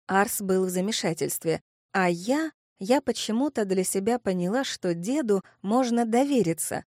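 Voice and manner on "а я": plain reading voice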